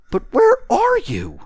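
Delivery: very high, loud plea; tramp's high-pitched exasperation; baffled tramp. Acted. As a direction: call out in bewilderment. Tramp searches for invisible speaker.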